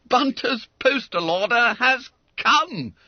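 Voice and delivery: high, loud, slow announcement; amazed proclamation. Acted. Schoolmate announcing the money to all, amazed. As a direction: proclaim a miracle to everyone.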